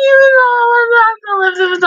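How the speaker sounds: very high fangirl squeal; high, loud gushing fan; excited squeal. Real, not acted. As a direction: shriek with delight at the kiss. Squealing over the kiss.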